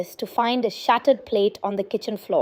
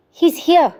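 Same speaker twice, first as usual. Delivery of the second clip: a frightened gasp, a bit louder; gasping in fear; 6 semitones higher, 5 dB louder than usual. A narrator's reading. Daughter waking from a nightmare, gasping in fear. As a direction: gasp it out, terrified he is back. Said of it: "she'd gasp".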